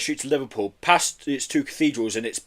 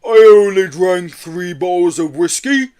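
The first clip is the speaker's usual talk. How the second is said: slow, louder far-off creature protest; protesting Yeti; 6 semitones higher, 10 dB louder, slower than usual. A narrator's reading. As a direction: insist like a tipsy Yeti.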